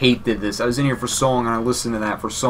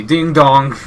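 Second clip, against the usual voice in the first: doorbell call; 3 semitones higher, 5 dB louder than usual. Pretend. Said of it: "Just stand at the door and say".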